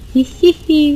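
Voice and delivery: sly villain snicker; sneaky giggle. Acted. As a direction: giggle like a scheming crook. Gloating over the milk theft.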